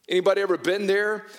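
ordinary delivery

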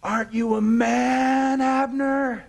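high, long-drawn mocking shout; taunting yell. David chides Abner from the far hill, yelling. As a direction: razz him at full holler across the valley. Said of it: "And David yells".

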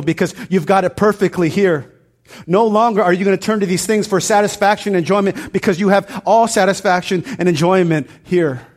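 loud, high, building proclamation; preaching at full force. Acted. Pastor voicing God's promise to the congregation, loud and building.

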